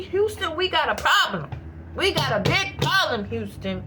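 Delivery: loud, punchy mock alarm; loud playful alarm call; Houston we have a problem. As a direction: bark it like mission control in trouble. Movie quote for emphasis, shouted.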